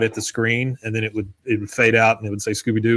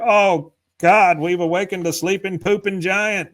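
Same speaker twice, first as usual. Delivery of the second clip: mock panic; 7 semitones higher than usual. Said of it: "they're just all screaming".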